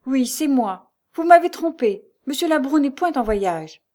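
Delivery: loud, high-pitched accusation; accusing. A narrator's reading. Lucie confronting the concierge who deceived her, accusing. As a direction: throw the lie back forcefully.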